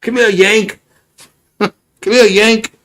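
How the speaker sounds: put-on high, teasing British accent with a laugh in it; mock British accent. Brits teasing the American as a Yank, mock British accent. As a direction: call him Yank like a cheeky Brit, British accent. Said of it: "Those Brits".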